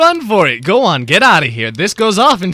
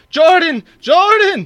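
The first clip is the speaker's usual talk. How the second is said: calling out; 10 semitones higher, 3 dB louder, slower than usual. Acted. Calling out to fallen comrade.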